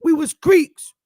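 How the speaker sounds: high, loud proud southern elder; imitating a proud old southerner. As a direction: declare it with pride like an old southerner. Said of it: "when you say".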